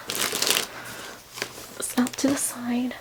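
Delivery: trailing off into a whisper; mumbled whisper aside. Real, not acted. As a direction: drop to a whisper as you move things aside. Tidying mess while talking.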